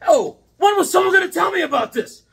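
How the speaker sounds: high, loud mock outrage; mock outraged shout. Comic fan yelling at his viewers in mock outrage. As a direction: shout it like you've been betrayed.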